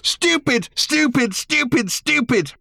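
high furious screaming; screaming stupid. A narrator's reading. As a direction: scream each word at him. Random screams at Arthur.

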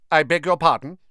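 high, stiff and offended; stiffly. Acted. Ravenclaw girl rebuffs Ron, stiff and offended. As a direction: draw back in prim offence. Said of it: "said the girl stiffly".

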